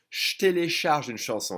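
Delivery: «Je télécharge une chanson» starting shtélécharge; J becomes sh. Shown as a demonstration. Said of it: "it'll be turned into a sh".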